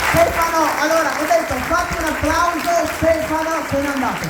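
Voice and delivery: loud, high call across the room. Real, not acted. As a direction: shout over the crowd. Calling out over applause.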